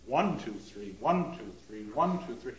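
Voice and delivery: one leaned on, two and three light; counting waltz time. Shown as a demonstration. Teacher counts three-quarter time like a composer. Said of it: "three-quarter time".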